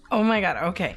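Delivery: ordinary talk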